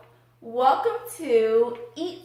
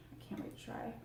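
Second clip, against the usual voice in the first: barely voiced aside, much softer; whispered; 19 dB quieter, faster than usual. Real, not acted. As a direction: whisper it to yourself between bites. Eating quietly, aside to herself.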